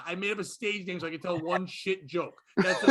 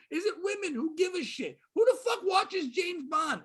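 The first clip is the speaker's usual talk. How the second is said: ranting; 9 semitones higher than usual. Acted. Comic rants at the audience about Bond fans. Comedic rant peak.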